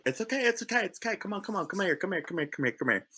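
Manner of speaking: soothing hug. Acted. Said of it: "goes in for the embrace".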